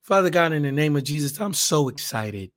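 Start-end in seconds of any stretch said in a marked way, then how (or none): none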